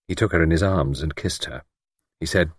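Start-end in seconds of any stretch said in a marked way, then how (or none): none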